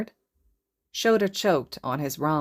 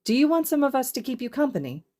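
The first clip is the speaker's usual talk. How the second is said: eager offer; 10 semitones higher, faster than usual. Acted.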